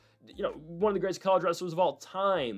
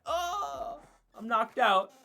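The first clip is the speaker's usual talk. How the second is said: much higher, theatrical swooning "Oh"; hammed-up fake knockout; 9 semitones higher, much slower than usual. Fighter pretending an up kick knocked him out, hammy swoon. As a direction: ham up a fighter faking a knockout. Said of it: "fake knocked out after he complains".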